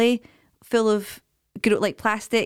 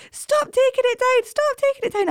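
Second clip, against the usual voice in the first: put-on very high, wailing little boy voice; whiny crying child. Mother imitating her crying son, high wailing child voice. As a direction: squeak it out like an upset toddler.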